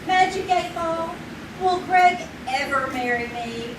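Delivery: ordinary delivery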